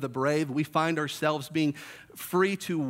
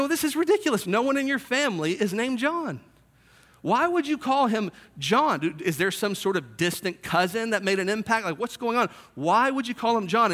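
indignant relatives; 4 semitones higher than usual. Acted. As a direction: protest like outraged relatives. Relatives argue about the name.

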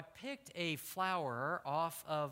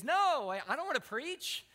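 high, loud mock-panicked refusal; distressed protest; 10 semitones higher, 6 dB louder than usual. Young man refuses pastor at the invitation, distressed protest. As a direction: burst out, imitating a distraught refusal.

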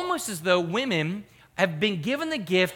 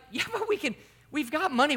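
retold husband pleading his case in a raised pitch; playfully incredulous protest; mock protest; 9 semitones higher than usual. Husband objects to packing, retold.

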